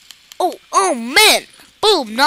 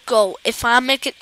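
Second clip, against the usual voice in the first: usual speaking voice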